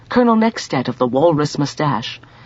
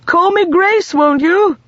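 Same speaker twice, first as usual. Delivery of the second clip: high, louder call across the room; 6 dB louder, slower than usual. A narrator's reading. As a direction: call it over the shoulder. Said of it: "Over her shoulder she called".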